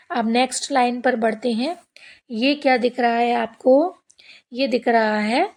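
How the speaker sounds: ordinary delivery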